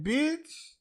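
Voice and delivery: high, distant mock-divine voice; lightly voiced Lord dropping one word; a hint of a lofty God voice. Lord's reply in [name]'s story.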